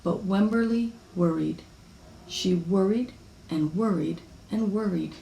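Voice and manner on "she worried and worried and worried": ordinary delivery, nothing marked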